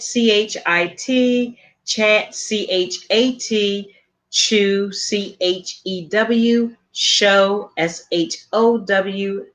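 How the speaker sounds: slow, clear spelling of each letter; spelled letter by letter. Shown as a demonstration. Host spelling the website name for listeners, slowly letter by letter. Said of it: "Again, that's w w w".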